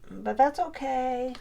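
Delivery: ordinary talk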